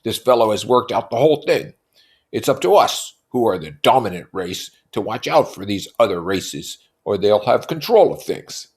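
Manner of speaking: loudly voiced Tom; Tom's voice. A narrator's reading. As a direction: lecture like a brute. Tom voiced in read novel.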